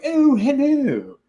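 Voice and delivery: put-on high, drawn-out posh child's voice; hammed-up posh British kid, loud and slow. Dad imitating his son, very posh British accent. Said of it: "British accents are very posh".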